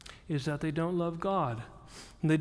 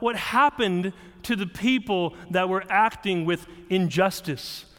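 ordinary talk, nothing marked

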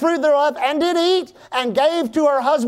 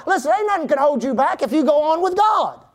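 ordinary delivery

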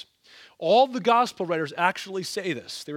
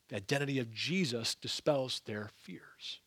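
hushed whisper for the key point; 5 semitones lower, 8 dB quieter than usual. Real, not acted. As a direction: lean in and whisper it. Sermon point delivered as whisper.

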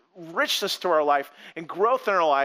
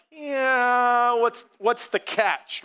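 skeptical; 3 semitones higher, much slower than usual. Retold or imitated. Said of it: "you're like".

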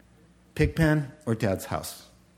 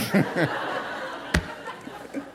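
laugh; 3 semitones higher, 4 dB quieter than usual. Real, not acted. Laughs after the joke.

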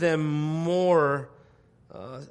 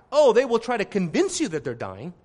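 much higher and louder, preaching at full tilt; full-force preaching; 7 semitones higher, 6 dB louder than usual. Acted. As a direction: thunder it from the pulpit.

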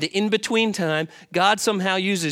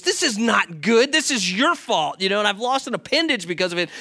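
irate king; 4 semitones higher, 4 dB louder than usual. Retold or imitated. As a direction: snap back at the friend in a mock rage. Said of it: "the king was irate".

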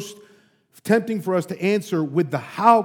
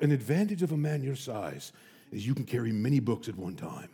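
much lower, much softer deadpan; dry professor remark; 9 semitones lower, 11 dB quieter than usual. Retold or imitated. Professor drily comments on student's size, low deadpan. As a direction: say it drily, almost to yourself. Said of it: "the only thing he said".

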